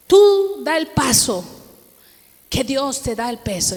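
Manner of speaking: a prophetess pressing her to step out; firm prophetic call. Retold or imitated. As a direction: lean on Tú like a command from the pulpit. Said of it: "Y me dice".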